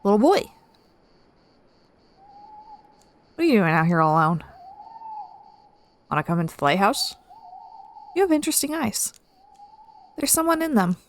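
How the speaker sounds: lightly attempted Russian accent, lifted pitch. Woman questioning a boy alone by the lighthouse, attempted Russian accent. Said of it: "this lady has a Russian accent".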